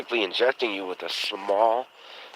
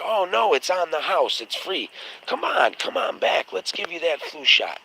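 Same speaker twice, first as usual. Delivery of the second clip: mock pushy pharmacist; 10 semitones higher, 3 dB louder than usual. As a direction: ham up a pharmacist luring him in.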